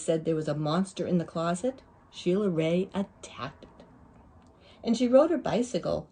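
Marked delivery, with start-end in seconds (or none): none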